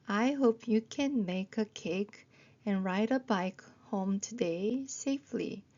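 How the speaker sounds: slow model sentence, joined into phrases; slow phrased reading. Shown as a demonstration. Teacher rereading the long sentence, slowly in phrases. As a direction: link the words but keep it slow.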